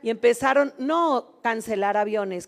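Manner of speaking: usual speaking voice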